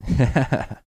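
short laugh. Real, not acted. Laughs at the ninja remark.

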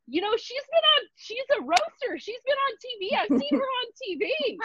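very high, excited fan voice; laughing intern gushing about the patient being on TV; giddy intern. Retold or imitated. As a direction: squeal it high like a starstruck fan. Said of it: "She goes".

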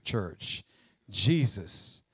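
ordinary talk, nothing marked

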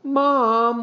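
put-on high, stretched-out boy's wail. A narrator's reading. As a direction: whine in dismay at Mom. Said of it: "wailed Henry".

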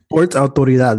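"Sports Autoridad" with Spanish vowels and tapped r; mock Spanish pronunciation. Guessing how his friend said authority, mock Spanish accent. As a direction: tease with a Spanish-accented store name. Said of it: "You would say".

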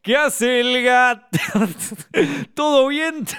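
a corny uncle at the door; the joker arriving at your house, high and slow; cheesy old joker. Retold or imitated. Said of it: "te dice".

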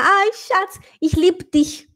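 mock ich liebe dich with clipped lib; light mock foreign accent; foreigner's German. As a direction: imitate a foreigner swallowing the e. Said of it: "o estrangeiro falar".

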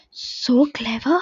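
slow admiring whisper; breathed in admiration. A narrator's reading. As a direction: breathe it out in awe, barely voiced. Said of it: "breathed Munia in admiration".

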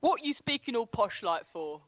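put-on brother's Staines accent. Brother at the dinner table teasing a returning student, Staines accent. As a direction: imitate your brother's outer-London Staines accent. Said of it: "my brother turned around to me and goes".

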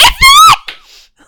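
short, loud, outraged shriek; screamed protest. Real, not acted. Fan protesting the non-punishment to her co-host, screaming. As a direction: scream it out in exasperation. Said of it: "Sorry if I'm screaming".

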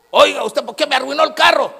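high, loud, mock furious complaint; angry caller. Sister phoning the pastor, mock furious complaint. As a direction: blast the pastor like an outraged caller.

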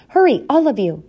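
high, urgent hurrying call; urgent cry. A narrator's reading. Mother rushes brood from the owl, crying out. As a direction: hurry them along in alarm. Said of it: "cried Hyacinth".

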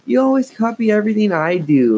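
high, loud angry complaint; angry protest. A narrator's reading. Lindsay scolding her copycat sister, angry and high. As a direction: protest hotly. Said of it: "Lindsay protested angrily".

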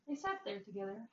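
ordinary delivery, nothing marked

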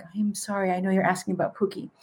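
very soft, careful voice; hushed, apologetic retelling; gentle apology. Retold or imitated. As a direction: break bad news softly after a breath. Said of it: "take a deep breath and be like".

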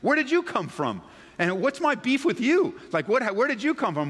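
baffled retort. Retold or imitated. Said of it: "You're like".

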